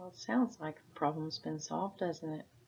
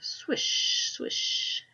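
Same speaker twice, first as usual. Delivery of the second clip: breathy whispered swish, swish like falling leaves. Acted. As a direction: hush it like leaves on a roof. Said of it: "The leaves fell on the roof".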